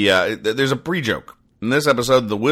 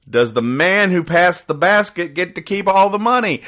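put-on kid voice; 9 semitones higher than usual. Kid's word balloon voiced.

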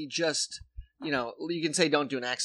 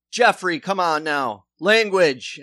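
louder, higher mild scolding father; mock stern dad; 3 semitones higher, 8 dB louder than usual. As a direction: scold your son mildly, like the Step Brothers dad. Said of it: "even like".

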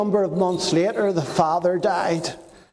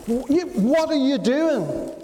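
mock angry outburst; 6 semitones higher than usual. Angry dealers shout at auctioneer.

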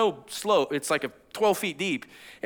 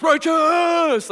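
high, drawn-out cheer; loud, elated whoop held long; excited turtle yell; 10 semitones higher, 6 dB louder than usual. Retold or imitated. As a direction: shout it like an excited cartoon turtle. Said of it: "just excited".

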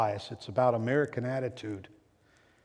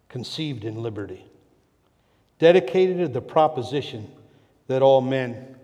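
solemn formal reading; 5 dB louder than usual. Shown as a demonstration. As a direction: read the Gettysburg Address aloud like an orator. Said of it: "I want to read these two hundred and seventy words".

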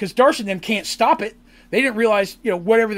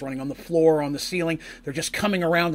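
ordinary talk, nothing marked

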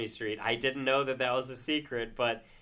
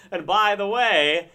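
loud, high, slow interjection; hammed-up plug breaking into the show; mock announcement; 8 semitones higher, 9 dB louder, slower than usual. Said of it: "to be like".

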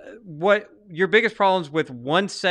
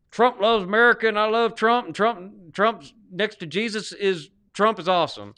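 exaggerated Southern accent; 6 semitones higher, 3 dB louder than usual. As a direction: lay the Southern accent on thick to mock the voters. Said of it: "you don't have to fake a Southern accent".

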